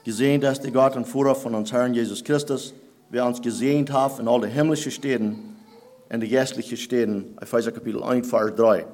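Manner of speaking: ordinary delivery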